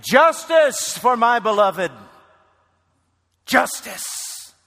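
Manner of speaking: high, loud ringing proclamation; Jesus. Acted. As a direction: cry it out as a triumphant decree. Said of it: "when Jesus will say".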